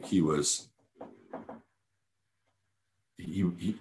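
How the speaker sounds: usual speaking voice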